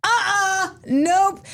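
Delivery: high-pitched, emphatic refusal; instant refusal. Retold or imitated. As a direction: shut it down at once, voice jumping way up. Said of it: "I immediately went".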